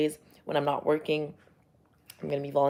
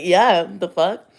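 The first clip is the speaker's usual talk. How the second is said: loud, drawn-out comeback; mock indignant retort; 2 semitones higher, 9 dB louder, much slower than usual. Speaker retelling their reply to shocked onlookers, mock indignant. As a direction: throw it back loudly, as if affronted.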